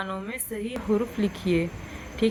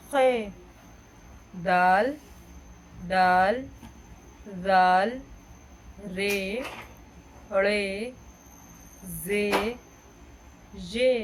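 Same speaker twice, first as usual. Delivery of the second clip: slow, spaced-out teacher recitation of letter names; alphabet recitation; much slower than usual. Acted. Teacher leads students reading the Urdu alphabet aloud, slow and clear. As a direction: call each letter clearly for the class to repeat.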